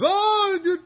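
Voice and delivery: very high, loud mock-happy squeal; overjoyed Filipino guy. Supermarket worker greeting a customer, in high-pitched delight. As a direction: imitate a man thrilled to see you. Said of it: "he was so happy to see me".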